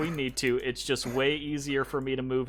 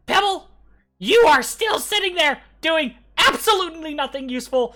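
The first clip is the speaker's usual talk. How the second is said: very high, loud furious goblin yell; goblin yelling back; 12 dB louder than usual. Acted. Digging goblin yelling at the idle one, high shout. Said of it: "the other one yells back".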